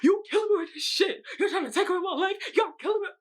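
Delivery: high-pitched mock screaming meltdown; R. Kelly. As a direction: wail it very high, hammed-up and half laughing. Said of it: "I legit was like, R. Kelly".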